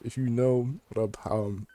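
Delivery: ordinary talk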